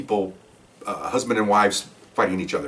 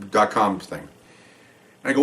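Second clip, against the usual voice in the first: usual speaking voice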